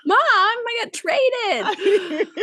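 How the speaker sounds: put-on high, drawn-out kid's wail; mock outraged daughter. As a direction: whine it like a betrayed child, laughing. Playing the daughter's imagined complaint.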